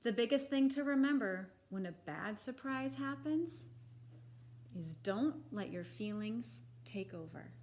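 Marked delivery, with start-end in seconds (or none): none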